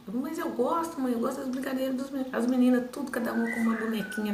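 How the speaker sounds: high, pleading retort to mum; protesting child. Retold or imitated. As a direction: voice a child arguing back, pitched well up. Child protesting to mother in story.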